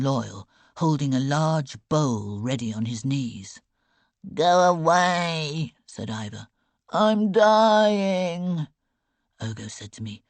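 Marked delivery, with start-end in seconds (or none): 4.3-5.7 slow, louder groaning dismissal; miserable sick boy. A narrator's reading. Seasick boy sends girl off, slow miserable groan. 6.9-8.7 slow, louder groaning dismissal; miserable sick boy. A narrator's reading. Seasick boy sends girl off, slow miserable groan.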